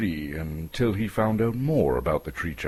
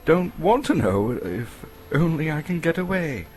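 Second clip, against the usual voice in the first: frightened; 8 semitones higher than usual. A narrator's reading. Frightened hobbit explains himself.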